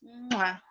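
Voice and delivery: quiet smacking air kiss; soft blown kiss. Real, not acted. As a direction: blow a soft kiss to the camera. Blowing a kiss goodbye.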